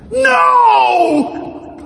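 huge, high, drawn-out shout; acted yell. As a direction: yell a refusal at the top of your voice. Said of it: "Why are you yelling at me?".

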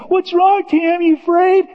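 put-on high, loud child teasing; teasing kid voice. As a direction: taunt him like a small child. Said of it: "little tiny cousins".

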